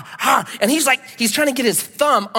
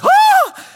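very high loud shriek breaking into a laugh; a mock scream of alarm; 8 dB louder than usual. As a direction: shriek half in horror, half in laughter. Man whips out a knife.

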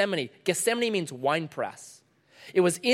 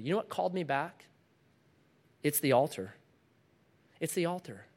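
ordinary talk, nothing marked

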